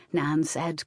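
usual speaking voice

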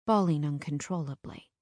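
ordinary delivery, nothing marked

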